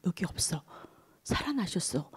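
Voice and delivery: hushed whisper. Acted. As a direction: whisper it like a wondrous secret. Angel tells women of resurrection.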